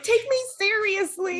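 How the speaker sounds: high-pitched mock tantrum whine; mock temper tantrum. Short speaker protesting at being called cute, mock tantrum. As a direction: demand it like a little kid mid-tantrum. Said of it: "you're throwing a temper tantrum".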